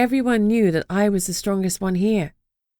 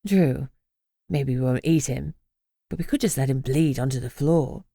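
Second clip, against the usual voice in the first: lower, coaxing voice; wheedling Esme; 5 semitones lower than usual. Acted. Esme coaxing Drew, lower and wheedling. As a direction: bargain sweetly for bloodshed.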